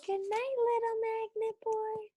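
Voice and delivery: sweet good night. Acted. Teacher bids the board good night.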